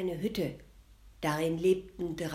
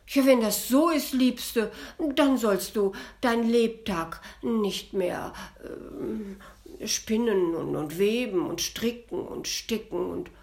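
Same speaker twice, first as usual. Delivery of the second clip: loud, eager declaration from the prince; 3 semitones higher, 6 dB louder than usual. Acted. Prince forbidding his wife all work, loud and eager. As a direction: boom it out as a royal decree.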